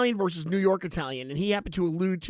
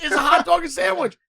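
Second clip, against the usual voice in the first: louder and higher, pushing the question; insistent repeat; 8 semitones higher, 5 dB louder than usual. Real, not acted. Host repeats the hot dog question to needle the guest, louder. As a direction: press the silly question again, louder.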